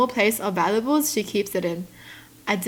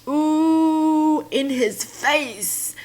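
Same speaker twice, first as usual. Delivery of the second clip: gloating ooh; 6 semitones higher, much slower than usual. Real, not acted. Gloating at a comment.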